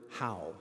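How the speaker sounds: single word how, clipped and set apart; staccato. Shown as a demonstration. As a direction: model how on its own. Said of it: "The first word".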